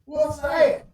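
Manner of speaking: put-on high, loud Southern kid voice; excited little boy, Southern accent. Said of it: "In a very southern accent".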